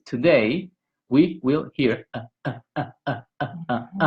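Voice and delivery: "Today, we will hear" chopped into separate beats with clipped uh, uh, uh breaks; choppy syllable-timed. Shown as a demonstration. Teacher imitating Spanish syllable-timed speech, choppy. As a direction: break every syllable apart. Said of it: "you will hear something like this".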